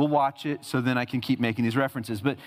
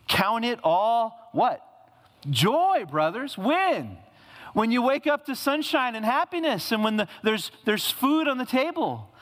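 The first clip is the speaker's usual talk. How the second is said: emphatic preaching; 8 semitones higher than usual. Acted. Preacher quoting James emphatically.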